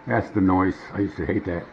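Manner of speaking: normal talking voice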